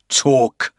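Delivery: loud, sharp barked command; barked order. A narrator's reading. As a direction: bark it like a threat. Porter barks order.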